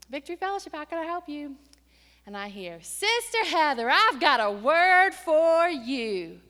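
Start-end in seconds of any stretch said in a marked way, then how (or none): 0.1-1.6 higher receptionist voice answering a call; higher phone greeting; bright phone greeting. Retold or imitated. 2.8-6.4 much louder, slow commanding preacher; slow, loud, weighty caller voice; booming prophetic caller. Retold or imitated.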